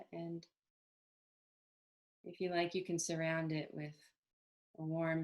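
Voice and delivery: calm, unhurried meditation guidance with long pauses; even, settled meditation voice; guided meditation. Acted. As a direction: gently lead them into body awareness, leaving space between phrases.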